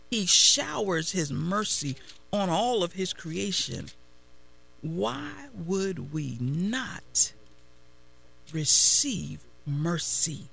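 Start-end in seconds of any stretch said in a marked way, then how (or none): 4.8-10.5 very slow, drawn-out rhetorical question; slow appeal. Acted. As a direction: stretch every word to make them think.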